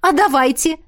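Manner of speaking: high, eager agreement; delighted. A narrator's reading. Miranda claps at the truth-beam idea, delighted. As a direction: jump at it with glee. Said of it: "обрадовалась Миранда".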